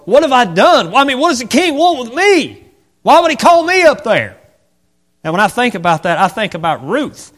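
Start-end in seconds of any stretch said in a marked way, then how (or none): 0.1-4.4 much higher, louder frightened cries; scared. Acted. Mephibosheth fearing the king, high frightened cry. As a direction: panic over the king's summons. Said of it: "Mephibosheth's scared".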